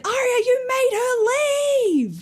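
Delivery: high, slow mock-scolding retort; mock Ella. Podcaster voicing Ella scolding Arya, high and slow. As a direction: drag it out high like a put-upon mum.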